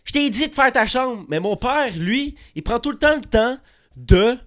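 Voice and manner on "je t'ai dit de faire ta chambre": high, loud scolding mom; hammed-up scolding mother, high and loud; imitating his mother. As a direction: nag like a fed-up mother, pitched high. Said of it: "elle va faire".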